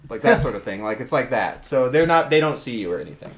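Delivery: ordinary delivery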